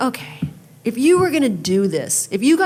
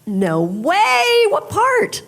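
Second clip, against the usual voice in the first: disbelief; 13 semitones higher, 4 dB louder, much slower than usual. Retold or imitated. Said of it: "And I'm like".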